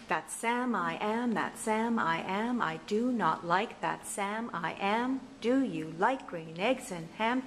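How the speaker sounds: sing-song verse with a regular beat on every second syllable; strong meter. Shown as a demonstration. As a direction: lean on SAM and AM in a bouncy rhythm. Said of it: "in a very strong meter".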